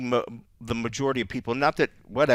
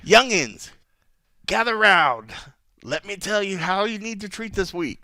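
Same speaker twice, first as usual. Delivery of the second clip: high, drawn-out summoning call; old-timer addressing youngsters; 8 semitones higher, 4 dB louder, slower than usual. Acted. Veteran telling youngins how to treat the week, hammed-up elder. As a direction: call the young ones round like a village elder.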